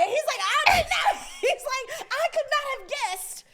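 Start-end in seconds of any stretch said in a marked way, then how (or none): 0.4-1.2 very high, loud mock protest; squealed dad denial, laughing; shouted denial. Dad's panicked denial to mom. 2.1-3.4 high, loud, slowed mock outrage; hammed-up disbelief breaking into laughter; exasperated rant. Dad's exasperated defense, storyteller laughing.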